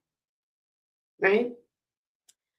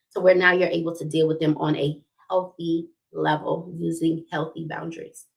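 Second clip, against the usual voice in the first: normal talking voice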